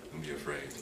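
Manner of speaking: low, soft and gentle; calm them down softly; soft reassurance. Shown as a demonstration. Preacher giving Jesus' words, softly reassuring.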